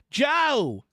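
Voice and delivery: high-pitched warning cry; warning call. A narrator's reading. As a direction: call a mate to alert him. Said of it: "cried the coachman in a warning voice".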